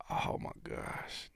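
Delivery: slow, whispered disbelief; awed whisper. Real, not acted. Host overwhelmed by the moment, whispering in awe. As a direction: let it out under your breath.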